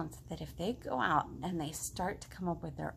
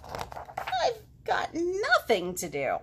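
mock wail; 9 semitones higher, 3 dB louder, much slower than usual. Frog wails about school.

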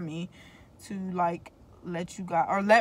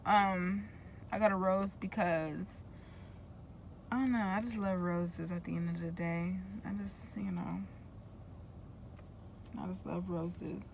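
ordinary talk, nothing marked

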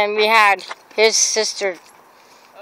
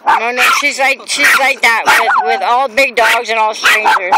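normal talking voice

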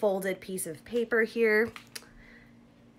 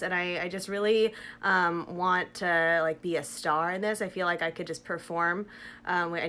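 face covered by wet mask; speaking with lips framed by mask; through a sheet mask; 2 semitones lower than usual. Real, not acted. Seen 4: Printed sheet mask covers whole face around mouth throughout; references unmasked.

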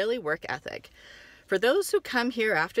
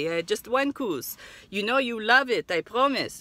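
put-on Israeli accent; 2 semitones higher than usual. Seller pitching couscous.

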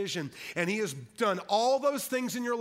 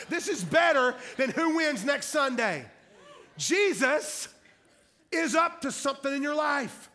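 raised, emphatic pulpit proclamation; fired-up preaching; 5 semitones higher, 3 dB louder than usual. Real, not acted. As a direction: proclaim it high and strong, with pauses for weight. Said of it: "I'm not sorry I'm getting fired up".

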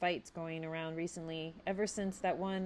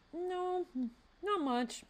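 half-hearted; 4 semitones higher than usual. A narrator's reading. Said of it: "said half-heartedly".

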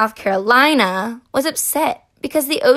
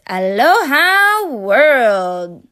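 slow, loud, stretched-out aloha in a put-on voice; drawn-out character greeting; 5 dB louder, much slower than usual. Kansas greeting its new ocean spot, slow put-on voice.

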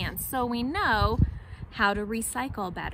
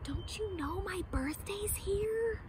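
higher, softer secret aside; monkey whisper; 7 semitones higher, 8 dB quieter, slower than usual. Acted. Monkey whispers to the lion, soft and secret. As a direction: whisper it into the ear. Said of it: "He whispered".

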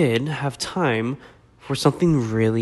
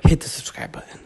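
whisper; faster than usual. Pretend. Final whispered call.

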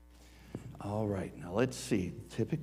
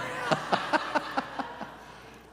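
short high airy laugh; a surprised burst of laughter. Real, not acted. Reacts to early service.

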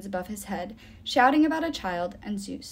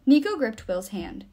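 usual speaking voice

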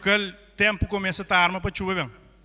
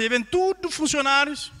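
ordinary talk

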